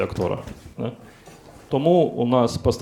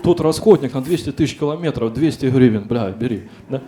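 lightly voiced car reseller, louder; used-car dealer's pitch; 3 semitones higher, 7 dB louder than usual. Car reseller haggling with a buyer, louder mock sales pitch. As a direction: push the sale like a mock reseller. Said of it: "у перекупа намагаєшся купити авто".

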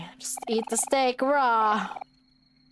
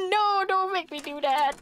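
much higher pleading whine; high whiny plea; 12 semitones higher than usual. Real, not acted. Player begging the game not to check the mirror, high whine.

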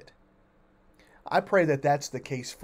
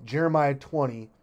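ordinary talk

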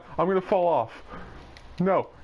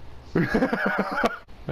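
short, breathy cackle; amused laugh; 5 semitones higher than usual. Real, not acted. Gamer laughing after a friend curses them, amused laugh.

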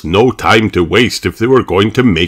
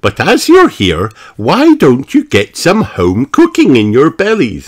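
higher, hospitable diner host; Miss Rabbit; 6 semitones higher, 4 dB louder than usual. Acted. Miss Rabbit offers the family home cooking, hospitable. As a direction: invite them in with a lilting high voice.

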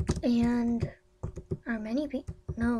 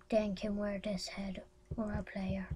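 whisper; 2 semitones lower, 10 dB quieter than usual. Real, not acted. Whispered reading.

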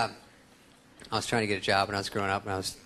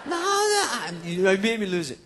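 laughing; 10 semitones higher than usual. Real, not acted. Cracking up in high voice.

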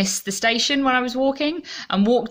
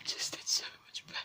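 pained whisper, much softer; 16 dB quieter than usual. Real, not acted. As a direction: breathe the words out in pain. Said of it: "try not to cry".

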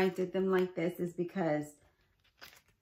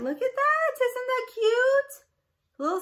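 gushing cute voice; 6 dB louder than usual. Real, not acted. Showing her handmade envelope to viewers, high gushing coo. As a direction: coo at it like something adorable.

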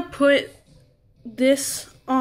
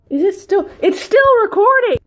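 a sudden, much higher, louder squeal; startled realization; 8 semitones higher, 8 dB louder than usual. Real, not acted. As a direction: shriek as she realizes the camera is still rolling. Finds camera still recording.

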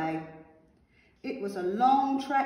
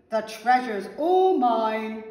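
loud, slow, greedy gloat; villain's shout; 9 dB louder, slower than usual. A narrator's reading. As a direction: crow possessively like a storybook villain. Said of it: "shouted the pea".

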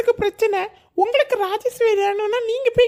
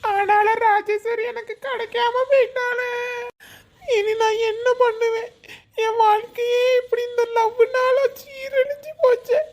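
hammed-up wailing. Laments losing Rajeswari.

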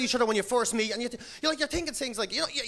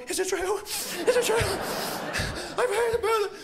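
higher, awed hammed-up murmur; mock wonder; 8 semitones higher, much slower than usual. Comic to crowd, drinkers in mock awe. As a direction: gasp in mock awe at the bar. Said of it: "Irish people walking going".

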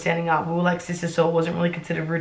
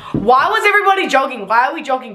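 high, louder exasperated questioning; incredulous outburst; 7 semitones higher, 5 dB louder than usual. Real, not acted. Writer ridiculing her old story, incredulous high outburst. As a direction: demand it in exasperated disbelief.

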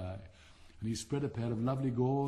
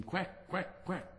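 duck quack; 7 semitones higher, 4 dB quieter, much slower than usual. Acted.

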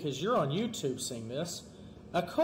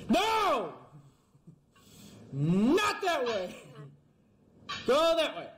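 frantic yelling; 6 semitones higher, slower than usual. Real, not acted. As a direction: bark orders at the climber. Yelling at the character.

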